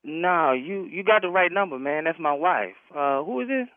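ordinary talk, nothing marked

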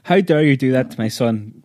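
usual speaking voice

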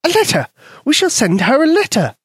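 high, tiny mouse voice; squeaky mouse voice. A narrator's reading. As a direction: voice a small eager mouse. Mouse Polka speaks.

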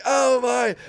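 high, loud disbelief; blown-away gasp of words; shocked exclamation. Retold or imitated. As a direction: cry out, stunned. His stunned reaction, brain just blew.

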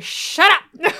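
villain yelling at McCloy, laughing; mock villain snap. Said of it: "he's like".

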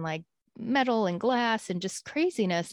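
ordinary talk, nothing marked

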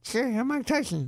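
Tyson's high, soft actual voice; real Tyson voice. Retold or imitated. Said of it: "you expect him to be like".

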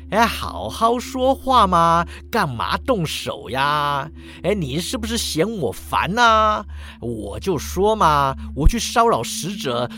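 drawn-out, hammed-up coaxing; wheedling skeleton. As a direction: stretch the phrase ends and talk your way out. Skeleton keeps pleading from its cage. (骷髅拖着长腔讨价还价)

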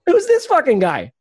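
squeaky high mock outrage, way up in pitch; mock jaguar voice. Jaguar startled by a stranger, mock high voice. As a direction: play the jaguar as a shocked little busybody. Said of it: "he was just like".

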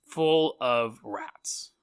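slow, low, quiet reveal; spooky slow drop; ominous hush. Acted. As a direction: let the dread sink in slowly. Spooky storytelling about rat ship.

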